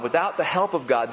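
ordinary delivery

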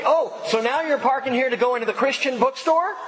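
hammed-up angry driver; imitating her yelling. Retelling the driver who followed him, in a mock high yell. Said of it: "she starts yelling at me".

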